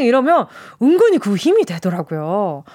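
usual speaking voice

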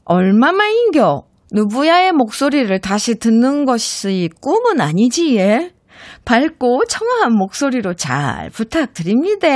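hammed-up Gyeongsang accent, warmly greeting an old friend; Gyeongsang dialect. Radio DJ reads a listener's message in Gyeongsang accent. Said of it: "이거 경상도 사투리 버전으로 해보".

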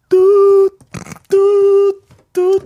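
high loud beeping; dial tone beeps. Pretend. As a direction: imitate a hung-up line. Hang-up tone imitation.

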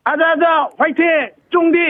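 high rallying cheer, shouted out; power up scream. Real, not acted. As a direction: belt out a pumped-up fighting cry. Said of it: "기합 한번 힘차게 외치고". (힘차게 외치는 기합)

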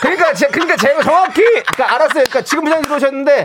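comically indiscreet protesting; loud flustered coworker. Acted. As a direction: babble reassurance far too audibly. Coworker loudly flustered on phone.

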